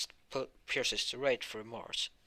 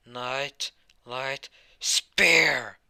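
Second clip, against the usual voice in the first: slow, drawn-out battle cry, much louder; spell shout; 2 semitones higher, 11 dB louder, much slower than usual. Acted. Hero unleashes his giant spear spell with a slow shout. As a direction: bellow the attack name like an anime finisher.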